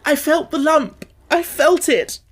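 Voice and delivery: high, loud, choking protest; choked cry. A narrator's reading. Colin to Mary, choking out his fear. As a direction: force the words out through tears. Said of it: "choked out Colin".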